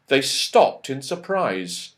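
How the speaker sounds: plain reading voice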